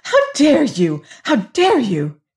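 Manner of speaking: high, indignant outburst; furious cry. A narrator's reading. Offended lady rounding on Hagar, high furious cry. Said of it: "cried Missus Delamere furiously".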